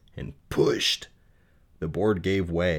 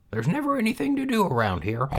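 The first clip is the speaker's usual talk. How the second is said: put-on high, lonely young pig voice; high-pitched bored piglet; Wilbur the pig; 13 semitones higher than usual. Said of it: "he thought".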